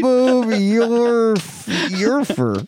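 slow, drawn-out backwards speech. Retold or imitated. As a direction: say it as if played in reverse. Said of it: "trying to say it backwards".

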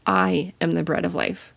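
"I" set apart and leaned on, rest even; stress on I. Shown as a demonstration. Teacher showing how emphasis changes a verse, stressing "I". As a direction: lean on the first word, "I". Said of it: "emphasize one different word each time".

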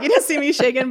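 loud, high retort on the edge of laughter; amused mock protest. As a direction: exclaim it in amused disbelief. Retold reply amid shared laughter.

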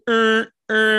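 loud, high horn honks; imitated car horn. Hunter imitates his truck horn going off, loud honking. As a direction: blare it like a key-fob horn. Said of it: "hit the fucking key fob and go".

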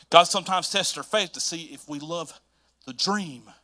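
normal talking voice

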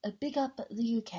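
mock UK shout-out voice; put-on UK voice. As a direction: do a playful, put-on UK accent. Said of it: "trying to do a U K voice".

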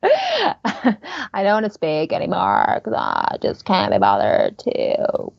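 put-on slow, sinking Valley Girl creak; lazy vocal fry. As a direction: imitate a girl who can't be bothered. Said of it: "too lazy to put any energy".

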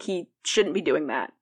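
ordinary delivery

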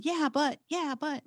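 imitating a dismissive friend; mock dismissive naysayer. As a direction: parody someone brushing you off. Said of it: "not have someone".

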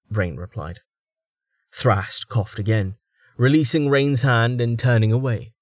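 ordinary delivery

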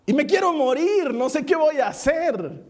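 high-pitched, stretched-out cry of dread; dramatized panic. Acted. Preacher dreading a talk to two thousand youths, dramatized panic. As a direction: wail it high and slow, hammed-up nerves.

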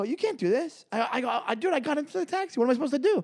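high mock pleading outrage; half-hammed desperate protest; retold protest. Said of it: "And I go".

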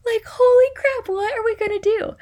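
high, loud mock panic; panicked retelling. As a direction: replay a frantic plea to a colleague. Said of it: "almost in full hives at that point".

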